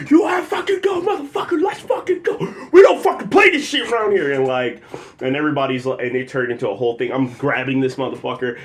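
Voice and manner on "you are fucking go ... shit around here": high, loud retold yelling; full-voiced shouting, re-enacting a blow-up; angry outburst. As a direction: explode as if squaring up for a fight. Acting out own angry outburst.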